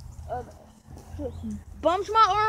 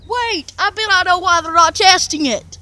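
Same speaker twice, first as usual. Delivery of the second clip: excited; 9 dB louder than usual. Real, not acted. Excited loud realization.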